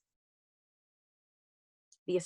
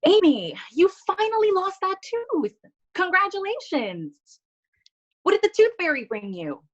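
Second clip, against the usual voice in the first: much higher, stretched-out teacherly delight; gushing teacher; 12 semitones higher, 3 dB louder than usual. Acted. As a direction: gush over a pupil in a high singsong. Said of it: "My teacher noticed".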